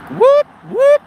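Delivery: high whooping siren noise; police siren imitation. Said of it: "this is sound of the beat".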